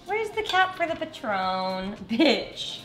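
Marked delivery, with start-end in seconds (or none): none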